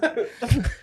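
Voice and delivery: short high laugh trailing down; laughing, falling off. Real, not acted. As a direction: keep laughing. Laughs again.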